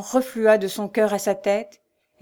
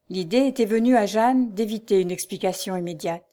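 plain reading voice

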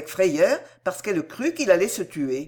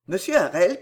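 usual speaking voice

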